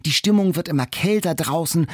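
ordinary delivery

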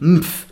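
"Mpf" as hummed m closing into pf; isolated consonant cluster. Shown as a demonstration. Teacher taking Strumpf apart, isolated final consonants. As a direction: say "Mpf" alone with no vowel. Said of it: "sind die Konsonanten".

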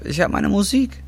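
pitched high, defiant; defiant boast. Acted. Defending his life to the dealer, high and proud. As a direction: throw it back at him, proud.

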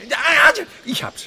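a high, loud cry of discovery; eureka. Acted. Wilhelm cracking the frog's riddle, excited. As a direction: burst out with the answer.

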